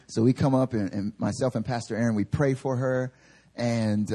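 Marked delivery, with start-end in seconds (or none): none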